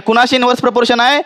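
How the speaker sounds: ordinary talk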